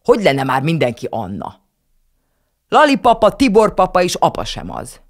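put-on child shouting at her sister; child yelling. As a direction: shout the argument. Children quarrelling at table.

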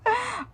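a quick, high real giggle. Real, not acted. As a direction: giggle at the slip. Laughing after oops.

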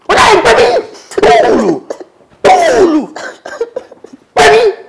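put-on high loud calls to a child; calling out. Pastor calling his child from across the room.

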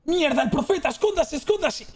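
fake alarm.

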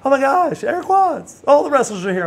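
high-pitched, gushing mock greeting; excited squeal of recognition, acted out; star-struck excitement. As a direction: imitate a thrilled fan spotting a wrestler. Staff greet a famous wrestler excitedly.

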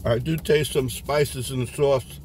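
wiping mouth with napkin; chewing while talking. Real, not acted. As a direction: mouth partly full. Seen 1: Bite taken before; mouth works food, wipes mouth with napkin while speaking.

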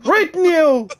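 high, loud furious yell; screamed order. Acted. Interrogator screaming at prisoner to cry, furious yell. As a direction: scream the order in his face.

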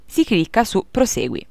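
normal talking voice

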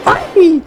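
sudden high startled shriek; startled scream. Real, not acted. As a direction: shriek in fright at the jump scare. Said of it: "niye çığlık atıyorum". (ani korku çığlığı)

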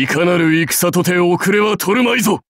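高く張り上げて言い切る; 士気を鼓舞する武将の声; 意気盛んな宣言. Acted. 出陣前に勝利を誓う. (勇ましく声を張り上げて)

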